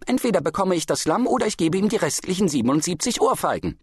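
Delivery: high, heated roar; bellowing. A narrator's reading. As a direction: bellow the ultimatum. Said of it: "brüllte er".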